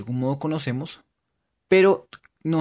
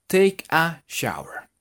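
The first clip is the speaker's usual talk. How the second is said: slow demo; 2 semitones higher, much slower than usual. Shown as a demonstration. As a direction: Take a shower drawn out word by word, slowly. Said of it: "slow teaching demo".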